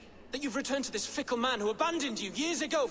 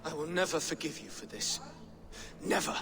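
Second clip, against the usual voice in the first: bitter; 7 semitones lower, 5 dB quieter than usual. Acted. Bitter final vow.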